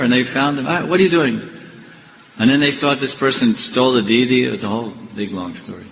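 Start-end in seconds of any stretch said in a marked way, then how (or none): 0.7-1.4 higher, startled question; mock shocked challenge. Pujaris finding the man by the river, mock shock. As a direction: act out catching someone unexpectedly.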